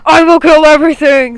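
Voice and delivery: wild power-hungry scream; crazed villain yelling his triumph. A narrator's reading. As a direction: scream it at full force. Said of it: "screamed a very power-hungry wolf".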